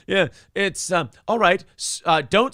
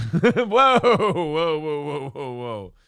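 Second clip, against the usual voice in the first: hammed-up whoa, fading out; mock startled stop; slower than usual. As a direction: cut yourself off in mock alarm. Pulling back from an offensive joke.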